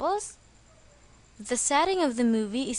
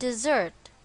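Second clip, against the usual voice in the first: "dessert" with the stress on its second syllable, di-ZERT. Shown as a demonstration. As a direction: lean on the end of "dessert", di-ZERT. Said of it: "the stress is on the second syllable".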